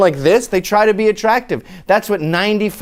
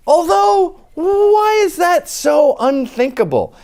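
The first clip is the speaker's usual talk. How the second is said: mock outrage; 11 semitones higher, slower than usual. Comic shouting at the crowd, high and outraged. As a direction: pretend to be scandalised by the idea.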